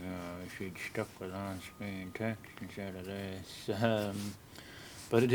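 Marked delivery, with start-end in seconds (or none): 0.1-3.7 soft, slow, disappointed viewer; lightly voiced complaining viewer; grumbling viewer. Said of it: "viewers wishing".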